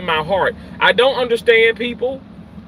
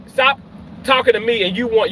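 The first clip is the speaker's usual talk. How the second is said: usual speaking voice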